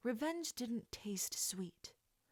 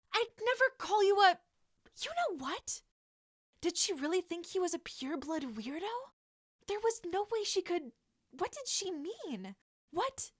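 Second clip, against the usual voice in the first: flustered, much higher; high panicked voice; 10 semitones higher, 7 dB louder than usual. Acted. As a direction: babble it out in panic.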